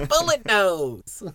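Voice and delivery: slow, high, put-on voice; silly character voice. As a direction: drawl out the name in a funny voice. Mimicking a character name.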